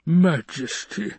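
high, drawn-out plea; slow, pleading appeal. Acted. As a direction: beg the ruler, rising at the end. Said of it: "the Baron pleaded".

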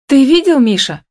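high, agitated woman's complaint; exclaiming in dismay. A narrator's reading. Upset wife confronting Миша over the sign, high-pitched exclamation. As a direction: demand an explanation, pitched high. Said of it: "вскрикнула она".

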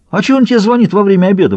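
high-pitched indignant protest. A narrator's reading. Маркер objects to a call during lunch, indignant. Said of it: "возмутился маркер".

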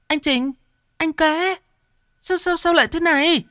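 very high woman's voice calling in panic; high frantic woman's calls; panicked calling. A narrator's reading. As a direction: shake them awake, panicking. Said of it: "Con Kim hốt hoảng".

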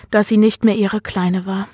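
usual speaking voice

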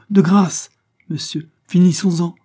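high, loud, urgent plea; dignified interruption. A narrator's reading. Isabelle interrupts Levison's offer, urgently dignified. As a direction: cut him short with dignity. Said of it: "interrompit la malheureuse femme en se redressant avec dignité".

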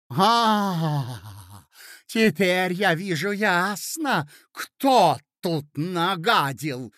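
furious. A narrator's reading. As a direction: spit out the rage. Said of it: "закричала ... вне себя от бешенства".

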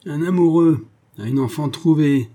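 loud, high-pitched indignant outburst; old master's outraged exclamation; angry scorn. A narrator's reading. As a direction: spit out scornful disbelief. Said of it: "la colère de Jacques".

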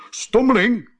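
high, loud indignant cry; calling out. A narrator's reading. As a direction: call out an insult in alarm. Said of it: "riep de Pat".

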